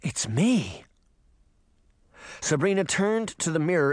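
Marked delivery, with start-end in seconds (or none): 0.0-0.8 gasped. A narrator's reading.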